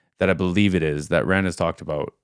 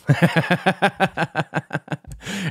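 short rising laugh; bright laugh; 10 semitones higher than usual. Real, not acted. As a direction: laugh it off, amused. Laughs at own rambling.

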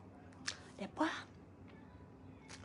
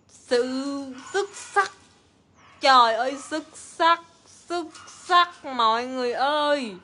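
excited; 3 semitones higher, 9 dB louder than usual. Real, not acted. Praising the lipstick loudly.

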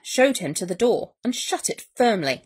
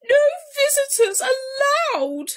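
tearful insistence; 4 dB louder than usual. A narrator's reading. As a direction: wail the house rule desperately. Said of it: "he sniffled".

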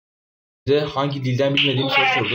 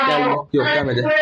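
panicked; 11 semitones higher than usual. Real, not acted. Hiding from Baldi.

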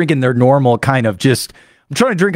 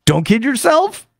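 ordinary talk

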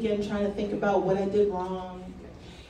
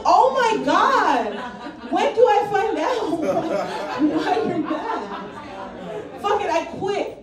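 louder, higher exasperated outburst; 5 semitones higher, 4 dB louder than usual. Retold or imitated. Answering boss Bill in the meeting, exasperated outburst. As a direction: throw up your hands at the boss. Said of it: "I'm like".

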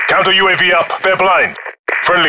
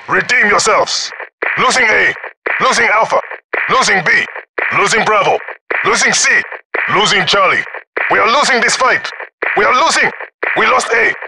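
raised, urgent announcer call; urgent battlefield warning, pitched higher; urgent alarm; 6 semitones higher than usual. Acted. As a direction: sound the alarm to the team.

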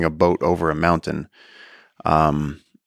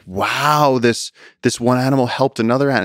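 high, mock-wowed voice; playing a wide-eyed city person; naive amazement; 6 semitones higher than usual. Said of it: "people be like".

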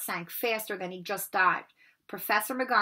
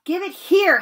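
loud, higher angry yell; 9 semitones higher, 11 dB louder than usual. Acted. Harry yells at Malfoy to hand back the Remembrall, loud and angry. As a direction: demand it back at full voice. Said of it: "Harry yelled".

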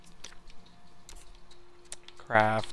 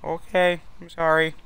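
higher, meek put-on voice; apologetic neighbour; 5 semitones higher, 3 dB louder than usual. Neighbour apologizing once more, high meek voice.

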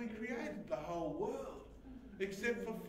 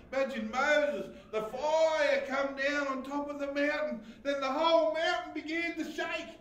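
loud, high, driving sermon delivery; full-force preaching; 7 semitones higher, 10 dB louder than usual. Acted.

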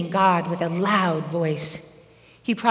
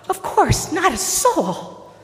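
very high, stretched-out shout back; calling back; 11 semitones higher, 4 dB louder, slower than usual. Acted. Healed man promises secrecy, calling back. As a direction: call back high and long, as if far away. Runner calls back to Jesus.

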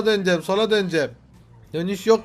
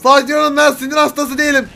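raised, higher irritated voice; losing temper; 5 semitones higher, 6 dB louder, faster than usual. Pretend. Driver denies bad nerves to his boss, losing temper. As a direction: insist loudly that you are fine. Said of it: "Biraz sinirlendim başkanım".

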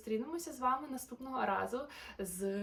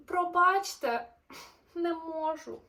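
apologetic refusal; 7 semitones higher, 8 dB louder, slower than usual. Acted.

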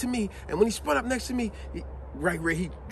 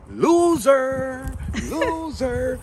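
mocking loser; 6 semitones higher, 6 dB louder, much slower than usual. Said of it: "my coworker said like this to me".

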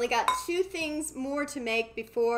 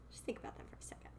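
whispered aside to viewers; 15 dB quieter, much faster than usual. Real, not acted. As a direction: breathe it, barely voiced.

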